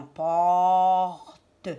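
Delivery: PORTE as a long POR, then TE; drawn-out syllables. Shown as a demonstration. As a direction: hold the O of PORTE, sound the final E. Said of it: "Est-ce que j'entends A".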